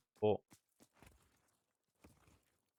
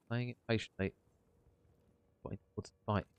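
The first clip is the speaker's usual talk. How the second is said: ordinary talk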